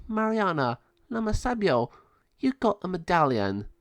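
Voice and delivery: put-on high, light old lady voice; a lightly voiced delighted grandma; grandmother. Grandmother Eva greets Mariana and Lama Sabio, high delighted voice.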